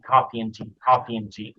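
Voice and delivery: "and" reduced to n, linked; coffee-n-tea; weak "and". Shown as a demonstration.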